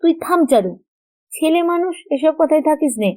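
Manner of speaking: mother's scolding. A narrator's reading. Mother hushes Charu for meddling in grown-up talk, scolding. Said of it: "তাহার মা ধমক দিয়া বলিলেন".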